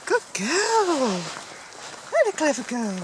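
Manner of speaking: high loud praise; squeaky praising a dog. Real, not acted. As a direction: cheer the puppy on brightly. Praising puppy off lead.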